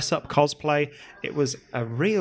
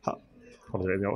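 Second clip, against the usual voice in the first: ordinary talk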